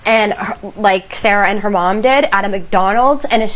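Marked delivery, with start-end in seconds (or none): none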